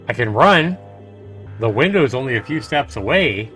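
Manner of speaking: ordinary talk, nothing marked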